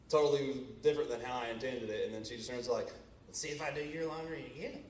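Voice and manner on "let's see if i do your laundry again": mock snippy wife; tossed-off sarcastic jab; snippy retort. Wife's snippy retort retold.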